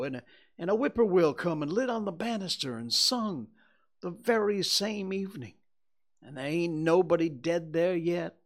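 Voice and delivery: put-on high, loud, eager boy's voice; excited country boy. Boy tells Tom about the stray dog omen, excited. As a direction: pour out the tale, pitched high and urgent.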